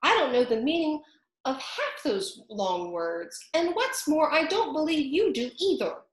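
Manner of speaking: put-on high, teasing young bird; cheeky eaglet. A narrator's reading. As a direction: tease the Dodo's big words. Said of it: "said the eaglet".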